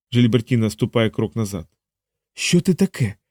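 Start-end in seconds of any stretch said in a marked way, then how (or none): 2.3-3.2 higher, tense hissed demand; a mildly venomous, high-pitched question. A narrator's reading. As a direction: hiss it in alarm, pitched up. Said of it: "сичить вона".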